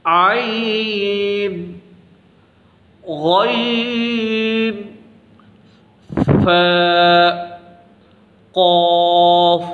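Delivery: عین and غین held very long, قاف heavy; slow careful recitation; drawn-out letter recitation. Shown as a demonstration. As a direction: stretch the letters slowly, make قاف thick. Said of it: "یہ قاف موٹا ہے".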